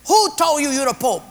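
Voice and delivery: put-on high, shouted Portuguese-accented challenge. Second patient challenging the Pope claim, loud Portuguese accent. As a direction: demand it loudly in a comic Portuguese accent. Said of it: "Second Portuguese guy said".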